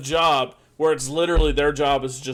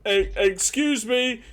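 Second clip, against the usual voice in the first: higher, drawn-out call for attention; 7 semitones higher, slower than usual. Acted. Guard flags down another guard, calling out. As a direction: hail someone, slowly and high.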